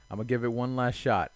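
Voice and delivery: usual speaking voice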